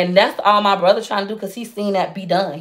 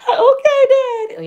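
mock reply to dad; 4 dB louder, slower than usual. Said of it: "I was like".

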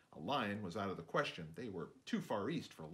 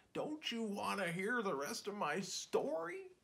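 whimpering; 12 semitones higher than usual. Acted. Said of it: "Kenneth's dad whimpered".

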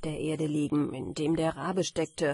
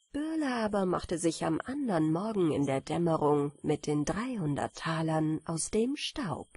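usual speaking voice

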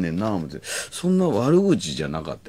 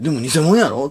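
high, loud teasing jab; playful tease; 8 semitones higher, 4 dB louder than usual. Retold or imitated. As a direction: tease her gleefully. Teasing Arii.

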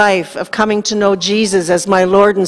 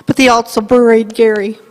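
teary. Real, not acted. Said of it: "I should have put that Kleenex in my pocket".

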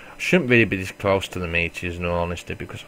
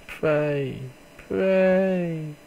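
high, strained pleading pray; anxious pleading; 9 semitones higher than usual. Real, not acted. Hoping the monsters didn't see him, high anxious plea. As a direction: beg under your breath in hope.

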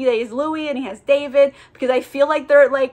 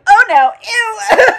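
high, loud drawn-out horrified cry; freaked out; 10 semitones higher, 10 dB louder, slower than usual. Acted. Demon reacting to ripping out an eyeball, freaked out. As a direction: blurt it in mock horror, dragged out. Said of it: "Memnoch freaks out".

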